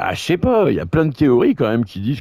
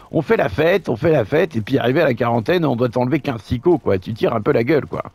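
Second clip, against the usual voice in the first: higher teasing sidekick voice; a cheeky buddy, pitched up; Roger voice; 5 semitones higher than usual. Pretend. Roger voice, knowing and mocking.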